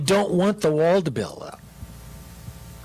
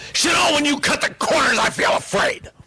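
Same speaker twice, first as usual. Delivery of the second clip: mock angry shout; 11 semitones higher than usual. Shown as a demonstration. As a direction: yell it like an accusation, then hiss the rest. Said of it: "She didn't say".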